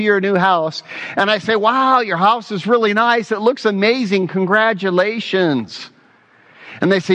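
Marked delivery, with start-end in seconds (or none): 1.6-5.9 higher-pitched, admiring congratulations; mildly excited praise. Acted. Pastor congratulating the new homeowner, mildly excited and higher. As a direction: lightly act out being impressed.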